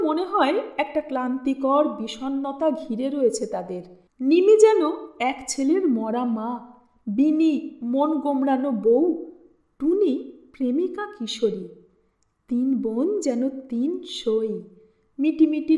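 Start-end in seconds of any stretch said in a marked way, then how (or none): none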